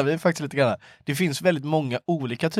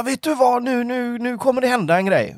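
high, eager mock announcement; excited acquaintance; 9 semitones higher than usual. Friend imitates a buddy announcing big news, high and eager. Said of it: "han bara".

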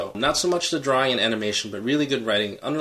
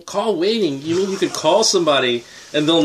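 mock amazement; 5 semitones higher, 4 dB louder than usual. Real, not acted. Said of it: "repeats call waiting".

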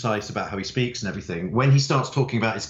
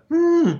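very high, louder "Hmm" held out; imitated listening hum; 6 dB louder than usual. Writer imitating Serena's listening noise during interviews, high hum. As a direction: mimic the interviewer's encouraging hum. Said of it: "noise all the way through".

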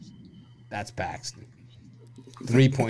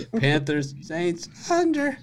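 slow, with Saints, under jumping far higher; mock inflection; 8 semitones higher, 5 dB louder, slower than usual. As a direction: ham up the giveaway rise. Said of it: "I caught the inflection there".

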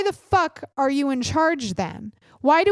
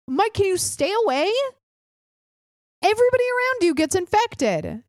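much higher pleading voice; pleading everybody; 9 semitones higher than usual. Retold or imitated. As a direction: beg Mike to keep away, rising. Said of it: "everybody was like".

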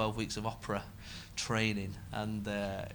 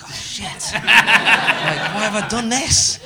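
louder, higher, slow, laughing mock dismay; laughing panic; 9 semitones higher, 13 dB louder, much slower than usual. Comic laughing at a title he regrets. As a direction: laugh at your own bad title. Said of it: "You're like".

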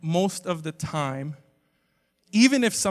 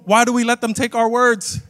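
ordinary delivery

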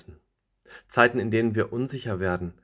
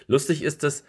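higher, lively calling out; calling leaf friends; 6 semitones higher, 4 dB louder, faster than usual. Acted. Leaf friends tempting the last leaf, high lively calling. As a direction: tease and tempt the lone leaf. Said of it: "riefen die Blätterfreunde".

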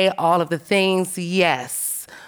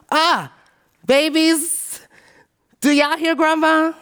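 calling out; 9 semitones higher, slower than usual. Retold or imitated. To the grandkids across the room, a high raised calling voice. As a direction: call the babies over, bright and raised.

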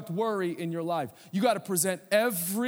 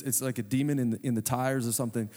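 ordinary talk

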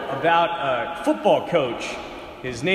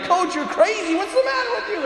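put-on high, panicky teenage voice; panicked kids; 14 semitones higher than usual. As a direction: freak out at the snakes. Kids panicking at loose rattlesnakes.

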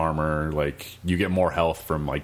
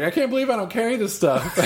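high, hammed-up sheepish whine; sheepish stranger. Stranger admitting he carries no tonic, high sheepish whine. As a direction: sound embarrassed at your own lapse. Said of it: "He's like".

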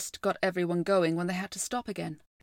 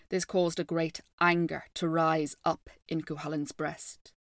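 plain reading voice

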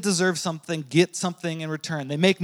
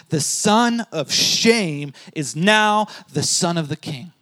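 ordinary talk, nothing marked

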